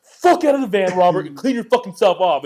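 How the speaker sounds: loud, high furious order; retold angry yell. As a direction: replay yelling at a friend you're pissed at. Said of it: "I was so pissed".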